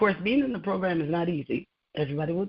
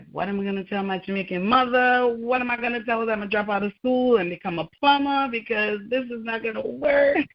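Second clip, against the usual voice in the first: fast, high, panicked rush; 5 semitones higher than usual. Retold or imitated. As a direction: spill it out in a worried panic. Panicked retold thought about mother.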